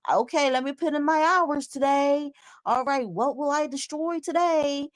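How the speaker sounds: high, chirpy clock-in voice; mock guest voice. Mocking the guest punching in to destroy, high and chipper. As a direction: play her as if wrecking is her job.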